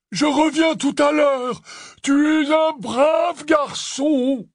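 very high, drawn-out loud holler; yelling. A narrator's reading. Hagrid yelling back to Grompy outside, hollering. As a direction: yell it to someone far outside. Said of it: "hurla-t-il à travers les carreaux brisés".

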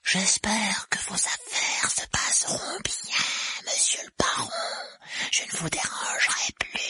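slow, whispery, obsequious rasp; bow out fawningly, drawing the words out; cringing spook voice, mostly breath; grovelling poltergeist. A narrator's reading.

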